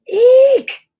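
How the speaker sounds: high loud shriek; shrieked eek. Acted. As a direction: squeal at the burn. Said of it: "I yelled".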